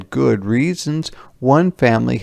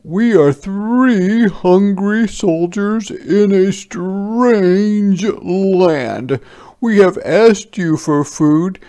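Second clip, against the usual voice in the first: much higher and louder, grand; proclaiming soldier; 11 semitones higher, 7 dB louder, slower than usual. Acted. Soldier announcing stone soup to villagers, grand raised voice.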